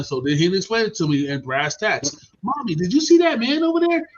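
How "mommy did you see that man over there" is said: put-on kid voice. Child asks mom in story.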